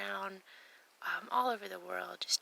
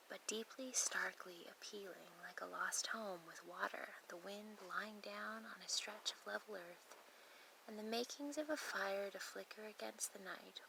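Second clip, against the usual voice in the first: soft, measured verse reading; poem reading; 8 dB quieter than usual. Acted. Hiker reading a poem about breaking camp, softly recited. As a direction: read it quietly, line by line, with small pauses.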